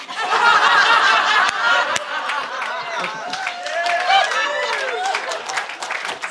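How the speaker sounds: long high laughter at own joke; cracking up; real laugh. Real, not acted. Host laughing at her own joke with the audience.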